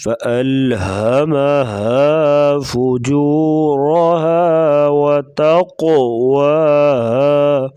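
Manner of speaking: slow, melodic tajweed chant; Quran recitation. Shown as a demonstration. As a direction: recite slowly and evenly. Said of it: "Quran recitation demo".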